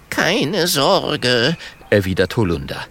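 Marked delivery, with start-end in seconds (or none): 0.1-1.6 lightly bleating, higher goat voice; a wavering goat telling a friend not to worry; reassuring goat. Acted. Holunder calms worried Mathilda, reassuring.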